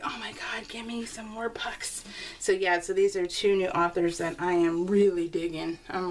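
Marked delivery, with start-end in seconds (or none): 0.0-2.0 soft breathy whisper of longing; hushed mock plea. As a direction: whisper it like a desperate book addict. Craving more books, quoted self.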